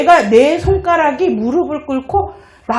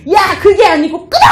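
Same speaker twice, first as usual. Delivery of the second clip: mock angry snap, louder and higher; angry yell; 5 semitones higher, 7 dB louder than usual. The speaker hanging up on her friend in anger, yelling. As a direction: bark it into the phone.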